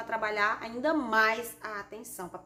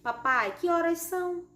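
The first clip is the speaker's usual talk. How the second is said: raised pitch on "que horas são"; question intonation; 7 semitones higher, 5 dB louder than usual. Shown as a demonstration. Teacher reads a child's question to papai, questioning intonation. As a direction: call PAPAI, then ask in a higher voice. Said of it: "é muito importante o uso da entonação".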